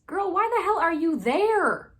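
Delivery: loud, high-pitched disbelief, drawn out; incredulous outburst. Real, not acted. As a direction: cry out at her terrible decision. Exclaims in disbelief at the plot.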